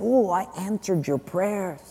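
high, hushed mock reminder; God. God replying to a quitting church worker, softly. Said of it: "God's saying".

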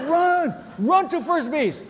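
loud high yell to the batter; shouting run. Retold or imitated. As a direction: yell it across the field, urging him on. Coach yelling at batter.

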